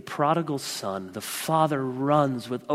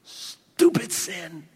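disgusted scorn; 3 semitones higher, much slower than usual. Shown as a demonstration. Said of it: "That should be our attitude towards sin".

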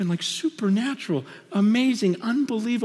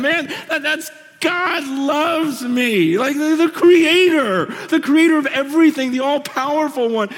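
high, loud, awed preacher with a tearful edge; preacher overwhelmed that God loves him; preaching at full force; 7 semitones higher, 5 dB louder than usual. Acted. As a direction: pour it out in wonder. Preacher marvelling at God's love.

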